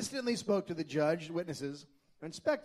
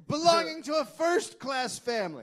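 high, shouted pompous boast; Charles voice; 12 semitones higher, 8 dB louder than usual. Retold or imitated. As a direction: proclaim it loud and proud. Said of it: "You got your Charles voice on again".

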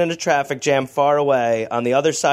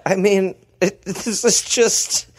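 usual speaking voice

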